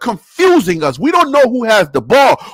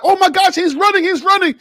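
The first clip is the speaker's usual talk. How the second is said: excited fooled fan; 9 semitones higher than usual. Retold or imitated. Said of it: "So my eyes is like".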